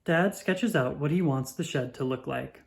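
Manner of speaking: ordinary delivery, nothing marked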